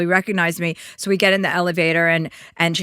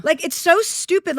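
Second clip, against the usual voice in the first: ordinary talk, nothing marked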